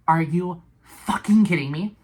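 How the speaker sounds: are you kidding me, spat out loud; exasperated outburst. Real, not acted. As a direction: vent the disbelief at full voice. Outburst at hung-up call.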